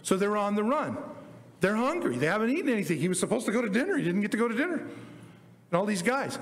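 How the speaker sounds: usual speaking voice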